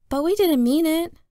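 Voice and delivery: put-on high, defensive kid's reply; protesting kid. A narrator's reading.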